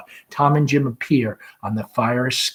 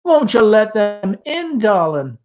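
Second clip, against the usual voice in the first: much higher, bright and sing-song; very gaily; 12 semitones higher, 7 dB louder than usual. Acted. Mother calls gaily so the guests hear. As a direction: put on a cheerful front for guests. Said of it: "very gaily".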